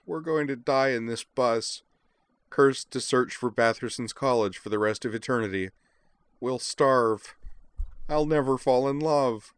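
high, melodramatic moan. A narrator's reading. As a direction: wail your doom, rising toward the end. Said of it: "Azalin moaned".

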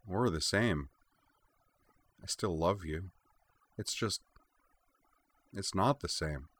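soft, drifting and a little slurred; distant and blurred. A narrator's reading. Anne confessing to Gunnar, softly blurred. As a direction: let the words fade like she's half asleep. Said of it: "sounding a little distant and somewhat blurred".

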